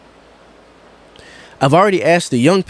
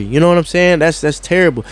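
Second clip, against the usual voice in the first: ordinary talk, nothing marked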